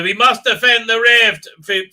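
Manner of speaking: loud, high mock-heroic declaration. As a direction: declare it like an overblown hero. Mocking a show's heroic line.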